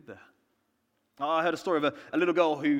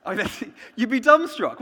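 louder, laughing through it; 5 semitones higher, 10 dB louder than usual. Real, not acted. Preacher imagining the Queen brushing your hair, laughing. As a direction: laugh at the absurd picture.

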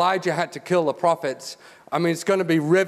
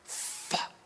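whispered curse; 15 dB quieter than usual. Retold or imitated. Elijah's quiet aside at the altar.

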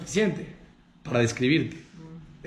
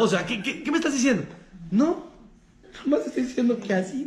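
ofendido; 6 semitones higher than usual. Acted. Continúa la indignación actuada.

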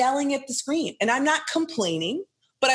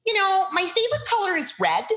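mock client voice; 10 semitones higher than usual. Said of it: "they're like".

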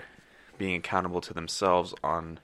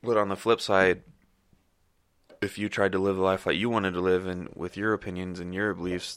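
usual speaking voice

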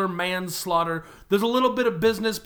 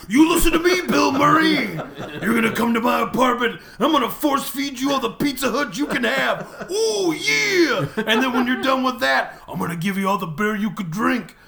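shouted, higher wrestler's trash talk, laughing; wrestling promo; 5 semitones higher, 4 dB louder than usual. Pretend. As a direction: bellow it like a wrestler cutting a promo. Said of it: "we just cut a wrestling promo".

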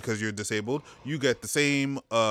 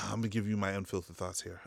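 quiet aside; 4 semitones lower, 9 dB quieter, faster than usual. Real, not acted. Lowering voice for confession.

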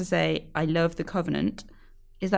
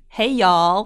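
light Texas drawl; Texas accent; 6 dB louder than usual. Shown as a demonstration. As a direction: say it like a Texan. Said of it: "In a Texas accent, please".

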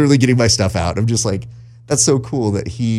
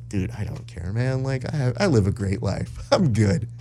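much softer, easy shrug; laid-back reply; 6 dB quieter than usual. Retold or imitated. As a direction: say it like nothing matters. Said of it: "he's just like".

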